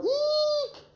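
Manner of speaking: sharp squeal shot up very high; grandma's eek. Shown as a demonstration. Coach asking grandma for a scared squeal. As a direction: let out a frightened little granny squeak. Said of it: "Grandma, can you go".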